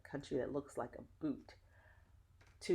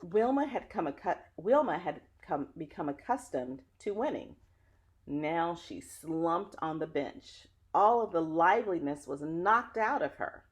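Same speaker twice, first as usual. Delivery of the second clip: put-on lively read-aloud voice for children; story narration; 2 semitones higher, 7 dB louder than usual. Reading a picture book to children, lively storyteller. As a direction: tell the story warmly to young listeners.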